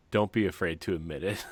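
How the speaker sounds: ordinary delivery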